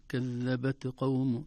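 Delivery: كذبت قوم with the ت of كذبت bouncing, not breathed; qalqala on the qaf. Shown as a demonstration. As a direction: recite كذبت قوم, slow, giving the ت a clear echo. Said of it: "فتصبح قلقلة وليست همس".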